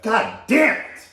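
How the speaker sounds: sudden angry yell; frustrated outburst. Real, not acted. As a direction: blurt it out in real frustration. Accidentally hit a button.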